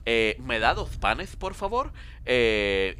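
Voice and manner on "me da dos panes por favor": put-on Castilian accent, lifting on the polite ending; Spanish accent. Dracula asking at a Spanish bakery, Spanish accent. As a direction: order bread in a mock Spain accent. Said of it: "hazlo con acento español".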